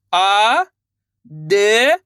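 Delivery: "आ", pause, "दे", each held long; letter by letter. Shown as a demonstration. Teacher leads pupils to repeat, drawn-out separate "आ", "दे". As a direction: sound out "आ" and "दे" separately. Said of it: "उनका उच्चारण करना है".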